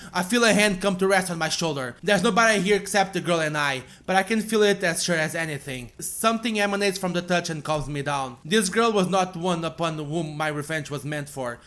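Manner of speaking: ordinary delivery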